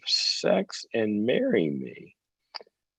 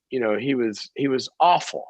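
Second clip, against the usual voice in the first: ordinary talk, nothing marked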